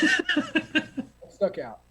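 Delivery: high, very slow, drawn out through laughter; laughing. Real, not acted. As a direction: laugh it out proudly. Repeats own line, second time laughing.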